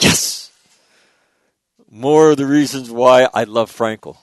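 loud, elated exclamation; excited. Retold or imitated. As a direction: burst out with delight over Frankl. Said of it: "And I'm like".

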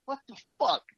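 high-pitched mock outrage; baffled outburst. Richard reacting to being called a doc, high and incredulous. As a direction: jump way up, indignant and incredulous. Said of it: "he's like".

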